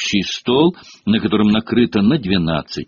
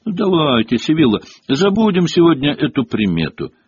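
impatient; 6 semitones higher, 4 dB louder than usual. A narrator's reading. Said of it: "нетерпеливо ответила МакГонагал".

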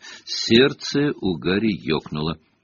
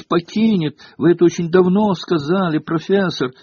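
very high, gushing pupil's voice, getting louder; excited exclamation; 9 semitones higher, 4 dB louder than usual. A narrator's reading. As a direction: pour it out high and thrilled, amazed at the prophecy. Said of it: "вдруг воскликнула Лаванда". (взволнованно, восторженно, очень высоким голосом)

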